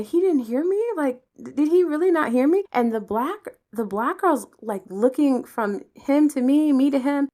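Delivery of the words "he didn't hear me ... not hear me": baffled inner voice. Retold or imitated. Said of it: "I'm thinking".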